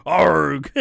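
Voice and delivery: loud, long comic "arrgh"; imitated commentator yell. Co-host mimics how the match's last segment ended, loud mock yell. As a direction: burst into an exaggerated mock yell. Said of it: "Josh Matthews going".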